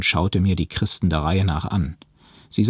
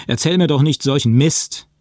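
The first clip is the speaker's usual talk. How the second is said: loud, raised-pitch yell of disbelief; indignant shout; 8 semitones higher, 5 dB louder than usual. A narrator's reading. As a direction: shout them down, outraged. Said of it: "schrie ich". (empört schreiend)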